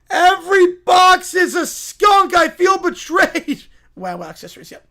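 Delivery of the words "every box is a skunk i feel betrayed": high, loud, melodramatic yell; betrayed wail. Acted. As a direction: wail it out, deeply wronged. Acted betrayal in script reading.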